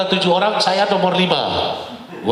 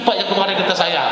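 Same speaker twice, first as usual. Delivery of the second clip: usual speaking voice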